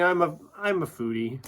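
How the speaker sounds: ordinary delivery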